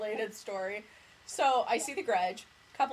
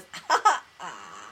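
imitated creepy baby croak; 8 semitones lower than usual. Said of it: "Lucas goes".

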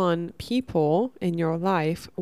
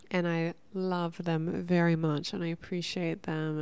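ordinary talk

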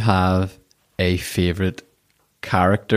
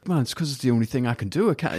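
a light, laughing put-on accent, quick; tossing off a hint of a borrowed accent, amused; [name] accent; 6 semitones higher, 5 dB quieter, faster than usual. Host quoting a musician's interview answer, in a laughing mock accent. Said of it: "I gave him that accent".

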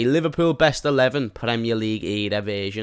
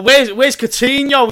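mock outraged fan; 12 semitones higher, 8 dB louder, slower than usual. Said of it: "younger fans are gonna be like".

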